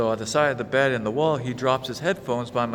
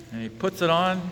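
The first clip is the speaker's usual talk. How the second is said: ordinary talk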